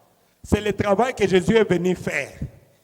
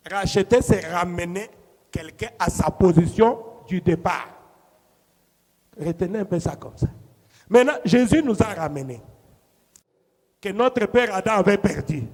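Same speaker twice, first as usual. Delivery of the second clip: ordinary talk, nothing marked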